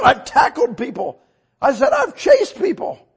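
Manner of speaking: loud, high, excited confession; hammed-up shouting. Preacher making woman laugh about his past zeal, hammed-up shout.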